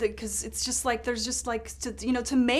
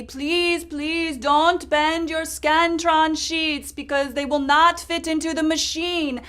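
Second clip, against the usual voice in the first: put-on high, loud accented yelling official; European man yelling; 6 semitones higher, 7 dB louder, slower than usual. Poll worker yelling at voters about Scantron sheets, high loud accented voice. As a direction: bark orders like a flustered European poll worker. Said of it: "yelling at everybody".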